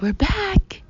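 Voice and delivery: high, loud excited call. A narrator's reading. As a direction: shout it with arms wide. Calling to parents down street.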